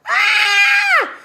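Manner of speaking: a very high, loud burst of joy; excited scream. Real, not acted. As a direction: let out a sudden high squeal of delight. Excited about the Jamaica retreat.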